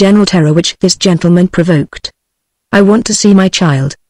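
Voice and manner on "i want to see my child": plain reading voice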